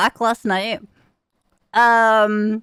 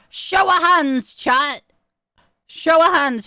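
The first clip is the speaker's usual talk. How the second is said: raised, higher-pitched call to a crowd; rally the chat, stretching the call; calling for attention; 6 semitones higher, 4 dB louder, slower than usual. Real, not acted.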